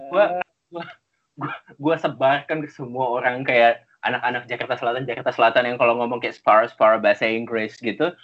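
usual speaking voice

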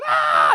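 short high-pitched scream; sudden loud shriek; retold scream. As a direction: scream out like a frightened kid. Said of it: "I start screaming".

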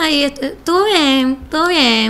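slow, unimpressed meh; mock indifferent verdict; bored shrug. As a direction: say it like nothing moved you, slowly. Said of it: "no que diga".

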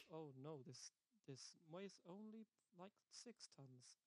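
slow, offhand understatement; nonchalant robot. Retold or imitated. Robot Robbie shrugging off a heavy load, slow and offhand. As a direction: drawl it like it's nothing. Robot casually downplaying weight.

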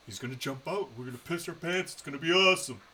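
retold giddy anticipation, climbing in pitch; building excitement. Fan predicting a jump scare to friend, mounting excitement. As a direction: start hushed-eager, build to a high, loud finish.

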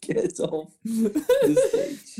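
high, slow, laugh-cracked heckle. Acted. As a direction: shout him off through laughter. Heckling director offstage.

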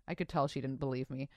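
ordinary talk

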